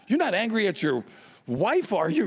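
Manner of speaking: ordinary talk, nothing marked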